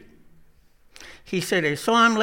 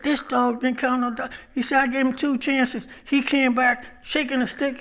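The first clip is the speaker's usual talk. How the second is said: higher, indignant hunting buddy; indignant Thibodeau; 6 semitones higher than usual. Retold or imitated. Thibodeau demands to know why his dog got shot. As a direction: squawk it out, outraged. Angry Thibodeau confronts Boudreaux.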